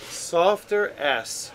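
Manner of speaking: slow echo of the correction; slow repeat. Shown as a demonstration. Repeating friend's correction back slowly. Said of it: "like a softer S and longer".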